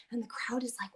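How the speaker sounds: ordinary talk, nothing marked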